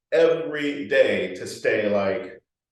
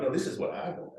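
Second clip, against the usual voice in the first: defiant inner voice; 5 semitones higher, 11 dB quieter than usual. Retold or imitated. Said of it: "You're like".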